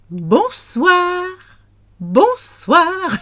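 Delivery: high, held "Bonsoir"; high French evening greeting; sing-song bonsoir. Shown as a demonstration. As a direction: lift "Bonsoir" way up for learners.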